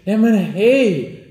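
put-on high, slow, overjoyed boy greeting; cheerful boy. Game master as the boy, delighted greeting. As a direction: beam at the visitors like a delighted kid.

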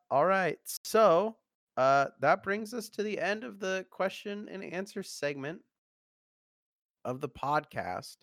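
loud, high host announcement; podcast announcer. Acted. Host announces segment end.

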